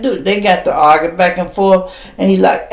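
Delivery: ordinary talk, nothing marked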